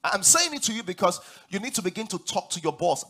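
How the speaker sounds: usual speaking voice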